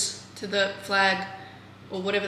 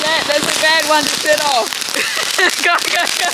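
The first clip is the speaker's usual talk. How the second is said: loud, higher laughing alarm; excited panic; 6 semitones higher, 7 dB louder than usual. Real, not acted. Setting off a bad firework with loud, higher excited panic. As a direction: yelp as it goes off.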